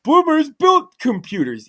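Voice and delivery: loud, high mock protest; Secret Agent Man. Quoting an opponent's argument back, loud mock protest. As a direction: throw it out as a slow, indignant retort. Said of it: "Secret Agent Man was like".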